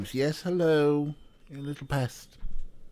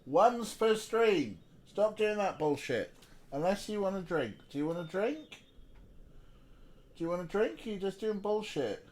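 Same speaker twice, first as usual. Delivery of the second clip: soft, higher-pitched talk to a little pest; playful scolding; 5 semitones higher, 6 dB quieter than usual. Real, not acted. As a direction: scold gently, as if cooing to a pet. Mock-scolding a little pest nearby.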